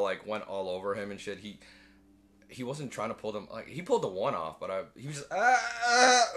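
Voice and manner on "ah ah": high, loud mock whimpering cries; panicked whimpers. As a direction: yelp like a man in a panic. Man panicking under the dinos.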